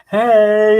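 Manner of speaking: high, loud, drawn-out hey; flamboyant greeting. Retold or imitated. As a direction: greet him with a flourish.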